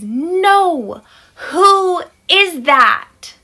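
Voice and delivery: put-on high, loud, very drawn-out child voice; insistent toddler. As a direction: wail each word slowly like a frustrated small child. Son insists, louder.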